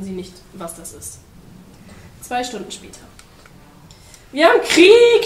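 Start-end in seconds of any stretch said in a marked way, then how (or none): 4.3-5.2 high, very loud battle cry; full-voiced high shout on KRIEG. A narrator's reading. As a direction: yell it as an alarm. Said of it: "schrie der Pinguin".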